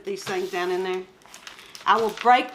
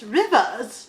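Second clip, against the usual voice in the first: "Rivers" said in a prim British accent; a prim British accent on "Rivers" with the r softened; mock British accent with a falling "Rivers"; mock British Queen. Shown as a demonstration. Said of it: "You should say it like this".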